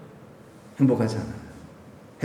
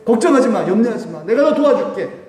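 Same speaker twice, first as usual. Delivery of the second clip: encouraging reassurance; 8 semitones higher, 5 dB louder than usual. Acted. Voicing Jesus reassuring.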